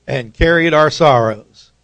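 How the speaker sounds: loud call of the verse; call for response. Shown as a demonstration. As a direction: lead the call and wait for the answer. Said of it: "I'll read the first half".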